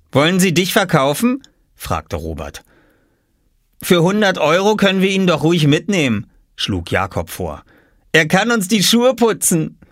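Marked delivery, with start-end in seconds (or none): 0.1-1.4 high mocking boy voice; lightly voiced teasing boy; teasing brother. A narrator's reading. Big brother teasing Oskar. 3.8-9.8 high mocking boy voice; lightly voiced cheeky boy; teasing brother. A narrator's reading.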